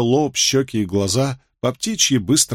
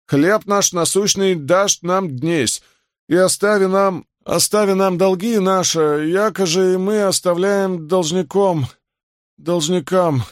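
high, slow, faltering prayer; halting recitation, pitched well up; distressed prayer; 9 semitones higher than usual. A narrator's reading. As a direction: struggle through the prayer, close to tears. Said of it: "он в смятении и даже готов разрыдаться".

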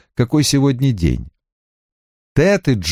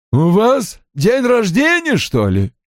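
crackly secretary's voice, question drawn out slowly and pitched up; low, crackly voice; 11 semitones higher, slower than usual. A narrator's reading. Secretary asks boss about his birthday while typing, crackly voice. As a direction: drawl the question in a crackly, put-on voice. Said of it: "спросила Пат низким, трескучим голосом".

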